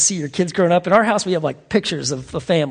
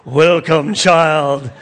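lightly voiced lion greeting, a bit louder; a booming hint of the lion king's welcome; movie Aslan voice; 4 dB louder, much slower than usual. Preacher redoes the film's Aslan welcome, mildly voiced lion. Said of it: "And it's like".

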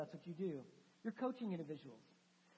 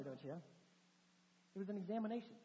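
normal talking voice

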